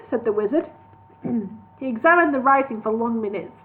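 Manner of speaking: ordinary delivery, nothing marked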